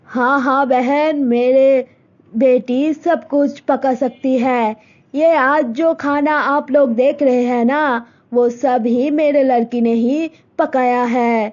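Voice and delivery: mother telling daughter about a proposal; Malti's mother. Acted. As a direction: speak as a set-in-her-ways village mother. Malti's mother flatters guests.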